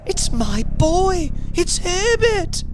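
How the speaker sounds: put-on very high, overwrought old woman; frantic cry. A narrator's reading. Mother struggling to reach her son, frantic cry. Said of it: "she cried".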